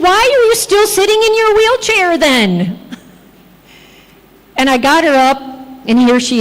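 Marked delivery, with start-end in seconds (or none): 0.1-2.8 high-pitched mock-incredulous question; incredulous challenge. Carer challenging pain-free patient in wheelchair, incredulous. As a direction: challenge her, pitch held high then falling at the end.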